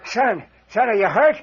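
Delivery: high, loud anxious call into the dark; worried shout. Acted. Father calling down to his fallen son, worried and loud. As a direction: shout down, scared he's hurt.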